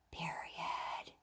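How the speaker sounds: soft, voiceless whisper. Shown as a demonstration. Teacher prompts the class to name the mark, whisper voice. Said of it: "hear your whisper voice".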